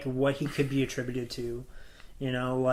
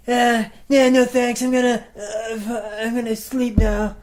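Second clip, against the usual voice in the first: high, slow dismissive drawl; lazy brush-off; 8 semitones higher, 6 dB louder, slower than usual. Retold or imitated. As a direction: brush him off lazily. Voicing Sonic's dismissal.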